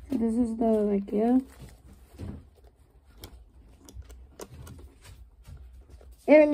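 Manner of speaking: muffled behind held mask; speaking through face mask. Real, not acted. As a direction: mouth covered by oxygen mask. Seen 0: Green oxygen mask held over mouth and nose throughout labelled words.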